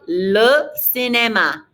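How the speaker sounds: LE CINÉMA slowly, for repeating; teacher modelling LE CINÉMA, word by word; LE, then CINÉMA, carefully spaced; slow model pronunciation. Shown as a demonstration. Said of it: "Répétez".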